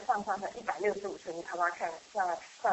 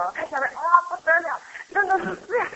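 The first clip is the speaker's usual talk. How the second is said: excited gasp of fright; 9 semitones higher, 7 dB louder than usual. Retold or imitated. Looking down from cliff edge.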